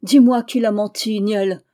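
loud, urgent plea to tell him it's a lie; passionate plea. A narrator's reading. Jouvente begging Niel to deny the lover, a loud passionate plea. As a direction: beg her with loud hope. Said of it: "dont un espoir passionné".

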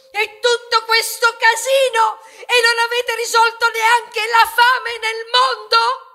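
loud, high furious tirade; angry shout. Acted. Wheat rages at humans over its genes, shouting.

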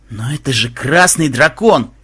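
ordinary delivery

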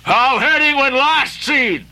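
slow, high, loud hail; calling aloft. Acted. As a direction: call up to the lookout. Ahab calls up.

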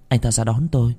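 usual speaking voice

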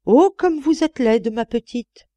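high, loud and slow, drawing out the insult; shrill-free but piercing high cry of scorn; cruel cry. A narrator's reading. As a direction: cry it out with open disgust. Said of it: "la dame cria".

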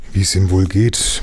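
usual speaking voice

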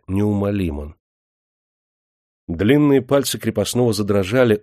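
usual speaking voice

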